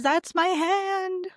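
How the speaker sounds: slow, high, proud Southern tour-guide drawl, hammed up; quoting the guide's proud line in a slow, high Southern drawl. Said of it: "and he says".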